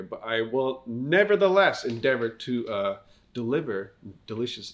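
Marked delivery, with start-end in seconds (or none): none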